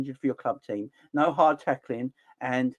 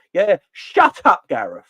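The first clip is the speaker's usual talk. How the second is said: mock shout at manager; 3 semitones higher, 8 dB louder than usual. Snapping at manager Southgate.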